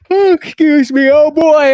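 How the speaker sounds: talking through a yawn. Real, not acted. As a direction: say it mid-yawn. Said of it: "I'm yawning".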